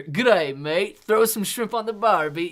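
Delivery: loud, high mock Australian greeting; hammed-up Aussie bloke accent. Australian from Down Under.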